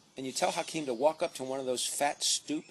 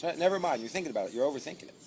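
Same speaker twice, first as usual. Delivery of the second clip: usual speaking voice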